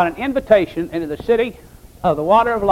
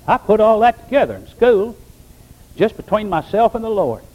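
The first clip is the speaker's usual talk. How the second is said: ordinary talk, nothing marked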